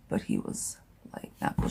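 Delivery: a hushed, breathy aside of his reaction; whispered quote. Retold or imitated. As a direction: whisper it like a secret. Voicing the man's reaction.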